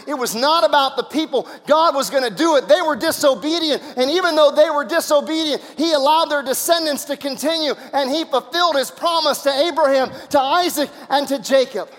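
high, driving pulpit proclamation; full-force preaching. Real, not acted. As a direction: hammer each phrase home to the congregation.

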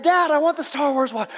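put-on high-pitched kid pestering his dad; pleading little boy. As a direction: nag Dad like a small child. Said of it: "he went to his dad".

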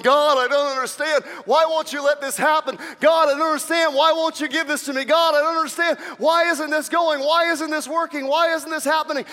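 loud, high-pitched protest to heaven; angry prayer. Acted. Frustrated believer to God over failed plans, angry. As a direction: shout up at God in hammered-out questions. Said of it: "we get angry going".

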